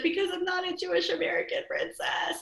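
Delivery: put-on very high, whiny little-kid wail; mock crying child. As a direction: imitate a tearful kid's complaint. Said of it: "I was crying in my room".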